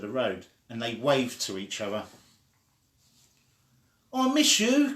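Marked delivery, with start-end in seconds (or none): 4.1-5.0 high, loud call across the street; child calling to her friend across the street. Acted. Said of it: "called the girl to her friend".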